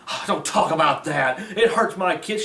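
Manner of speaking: lightly mocked complaint with a laugh; mocking whiny quote. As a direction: mimic the complainer with a slight sneer. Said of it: "you get mad at me for even mentioning it".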